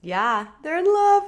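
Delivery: high excited gushing; excited squeal. Real, not acted. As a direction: squeal it happily. Excited about couple.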